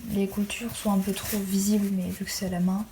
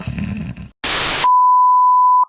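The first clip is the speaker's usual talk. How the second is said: loud laugh; 11 dB louder than usual. Real, not acted. Burst of laughter after aside.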